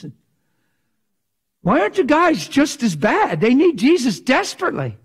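high, loud preacher's cry, driven hard; fiery preaching. Acted. Preacher rebuking the men, full-force loud preaching. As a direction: thunder it at the congregation like a revival preacher.

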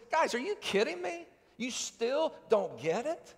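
exasperated, high-pitched incredulous plea, slowing down; a teacher at the end of his patience; mock-exasperated rebuke; frustrated disbelief. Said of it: "do you sense Jesus' frustration here".

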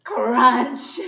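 put-on a big loud crunch for the kids. Teacher reading to children, loud crunch. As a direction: punch out the sound word crunch. Reading sound word with force.

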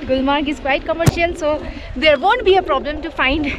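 ordinary talk

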